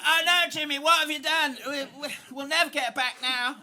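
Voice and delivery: put-on high-pitched voice of a dismayed Cockney girl; Cockney girl child. Cockney girl scolding Jimmy over the lost Frisbee, high dismayed voice. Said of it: "And she's like".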